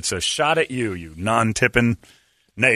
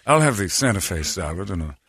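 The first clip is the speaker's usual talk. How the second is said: mock-regal British diner ordering; regal British accent; 3 semitones lower than usual. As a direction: order politely in a put-on posh British voice. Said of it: "they sound regal".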